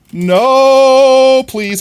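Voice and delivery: very high, loud drawn-out no; anguished wail. Real, not acted. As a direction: wail in pain and despair. Hit by many arrows.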